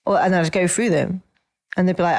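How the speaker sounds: usual speaking voice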